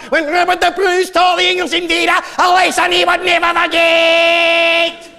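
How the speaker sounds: hammed-up Scottish battle cry, high and loud; Scottish boast. Mocking the Jocks about Bannockburn, hammed-up Scottish war cry. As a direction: roar it like a Scottish patriot, drawing out the end. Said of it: "you Jocks, you like to say".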